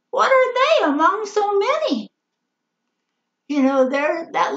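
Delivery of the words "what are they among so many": high-pitched, doubting question; doubtful question. Retold or imitated. Disciple doubting a boy's lunch is enough, high and incredulous.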